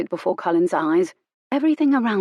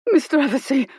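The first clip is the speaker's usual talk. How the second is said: terrified plea; 7 semitones higher, 3 dB louder than usual. Acted. Hunt pleads, quivering.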